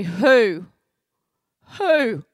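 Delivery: loud, high, startled who; hearing the family secret, a loud shocked who. Retold or imitated. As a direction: blurt it out in disbelief. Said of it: "I look at her in shock".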